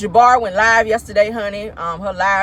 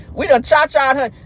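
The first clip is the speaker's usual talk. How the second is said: normal talking voice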